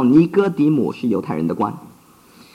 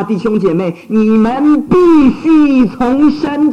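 raised, urgent sermon voice; fervent preaching; 8 semitones higher, 4 dB louder than usual. Acted. Preacher urging his flock to be reborn, fervent and raised. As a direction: plead with the hearer to be reborn.

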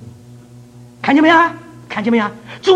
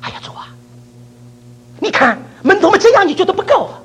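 inner protest; 14 semitones higher, 5 dB louder than usual. Retold or imitated. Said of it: "我就心里想".

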